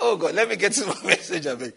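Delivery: ordinary talk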